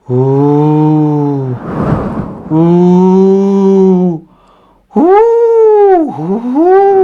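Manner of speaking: rising spooky wind howl. A narrator's reading. Narrator making the spooky wind sound, eerie howl. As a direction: moan like wind through trees. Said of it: "That was a scary sound".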